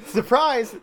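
high, loud playful exclamation; mock surprise. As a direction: throw out a big jokey reveal. Joins the running surprise joke.

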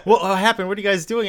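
high-pitched, confused questioning; mock bewilderment. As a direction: imitate a baffled boy demanding answers. Acting bewildered captain finding villains.

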